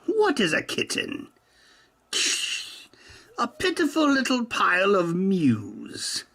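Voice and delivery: high hammed-up villain speech; mock theatrical challenge to the kitten; high mock-grand recitation; 1 mock dramatic.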